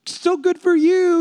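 high, slow, sugary mock well-wishing; mock sweet reply while secretly jealous; fake-nice comment. Fake-sweet comment to friends on vacation. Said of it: "You go".

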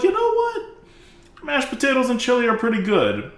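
high, bright-voiced mock prisoner making peace with his chili; content prisoner. To viewers, playing a prisoner pleased with his mashed potatoes, mock high voice. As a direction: squeak it out like a prisoner delighted with cheap food.

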